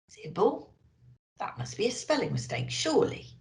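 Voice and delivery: quiet, quick, puzzled muttered aside. A narrator's reading. Narrator puzzles over an odd name on the list. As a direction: wonder aloud at a strange name.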